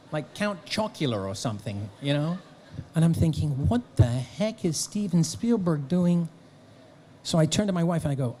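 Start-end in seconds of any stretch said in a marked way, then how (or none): 3.7-6.3 high, slow bafflement; retold disbelief, drawn out and pitched up; astonished thought.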